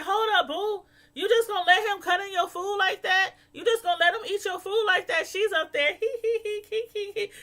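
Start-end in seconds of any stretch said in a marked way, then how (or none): 0.0-5.3 husband complaining. Retold or imitated. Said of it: "her husband was like". 6.0-7.3 high imitated giggle, hee hee hee; mock giggle. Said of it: "Just kiki".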